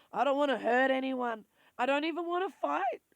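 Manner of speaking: pushing through a cracking voice; about to cry, pleading; voice breaking. Real, not acted. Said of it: "see, that's my voice".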